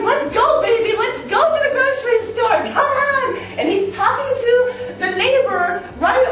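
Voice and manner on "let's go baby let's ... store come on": high, loud, laughing hurry-up; bubbling over to get out the door; giddy call to go; excited urging. Retold or imitated. Urging husband to leave, laughing.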